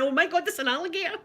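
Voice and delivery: high, fast mock panic; panicked. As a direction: blurt it out in a fake fright. Retold panic, second time.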